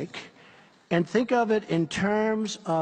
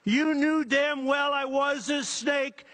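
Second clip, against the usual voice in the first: gloating snake shout; 8 semitones higher, slower than usual. Acted.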